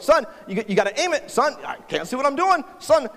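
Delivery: frustrated dad. Retold or imitated. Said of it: "And I'm kind of frustrated".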